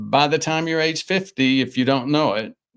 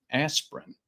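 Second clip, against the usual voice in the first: normal talking voice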